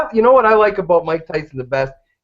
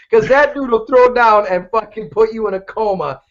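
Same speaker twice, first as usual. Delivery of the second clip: ordinary talk, nothing marked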